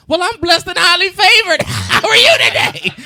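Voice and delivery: high, loud church-lady sweetness, laughing through it. Retold or imitated. Answering the stranger, high loud church-lady gush.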